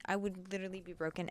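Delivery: usual speaking voice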